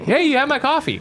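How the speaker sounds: high-pitched retold call-out; mock yelling. Husband seemingly yelling at wife, high mock yell. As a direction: imitate hollering down a street without full volume. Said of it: "she thought I was yelling at her".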